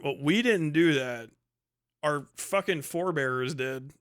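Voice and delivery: normal talking voice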